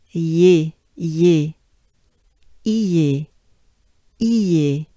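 "ye" and "iye" repeated with a gliding y; sound drill. Shown as a demonstration. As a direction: drill the glide into "ye". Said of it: "we'll transform into".

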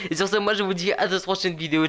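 high, upbeat sign-off patter; YouTube outro. Real, not acted. Gamer asks viewers to like and subscribe, high outro voice. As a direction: pitch it up and rattle through the channel plugs.

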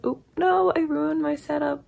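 sudden high yelp of surprise; high startled oop. Real, not acted. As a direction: caught off guard as the setup falls. Setup falls over mid-intro.

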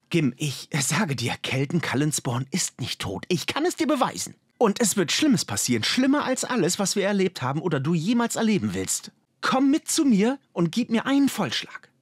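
high-pitched, mounting insistence; urgent appeal. A narrator's reading. Explorer begging his friend for time, urgent and insistent. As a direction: plead your case, climbing steeply as you go. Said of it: "Auffordernd sah Kilar den Arkan an".

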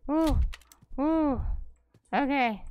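loud high startled exclamations; startled excitement. Real, not acted. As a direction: jump at the surprise. Startled by monsters.

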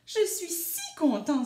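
high, loud delight; happy exclamation. A narrator's reading. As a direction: exclaim it with storybook glee. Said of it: "s'exclama-t-il".

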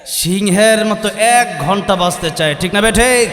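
slow, loud lion-like shout; fiery sermon shout to rouse the crowd. Real, not acted. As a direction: roar it out to the crowd. Preacher rousing crowd, shouting.

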